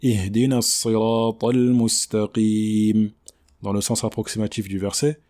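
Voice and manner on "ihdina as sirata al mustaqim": slow, measured chanted verse; Quran recitation. Shown as a demonstration. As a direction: recite the verse slowly with long held vowels.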